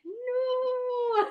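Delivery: high, loud hammed-up wail; mock despair. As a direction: pretend to be heartbroken for fun. Mock despair at broken glasses.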